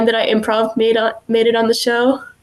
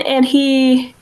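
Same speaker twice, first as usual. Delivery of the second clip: ordinary talk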